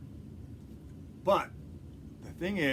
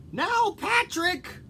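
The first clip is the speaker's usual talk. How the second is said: high, slow doting grandma; Granny voice; 5 dB louder, slower than usual. Acted. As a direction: call him like a sweet old granny. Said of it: "my Granny voice".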